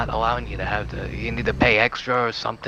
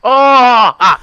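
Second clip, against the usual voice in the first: shocked yelp; 12 semitones higher, 13 dB louder than usual. Real, not acted. Shocked by daughter revelation.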